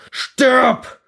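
high, loud battle cry; enemy's scream. Acted. German soldier screaming at you in the fight, high and loud. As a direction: scream it while wrestling with knives. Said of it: "schreit der Deutsche".

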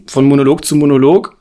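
ordinary talk, nothing marked

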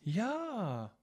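high, drawn-out "Ja"; demonstrated surprised yes. Shown as a demonstration. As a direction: stretch "Ja" up in astonishment. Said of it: "Überrascht".

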